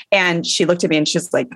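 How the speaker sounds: normal talking voice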